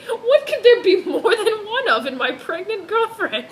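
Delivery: high, loud voice breaking into laughter; laughing mock voice. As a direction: ham it up through giggles. Mock Edward voicing, laughing.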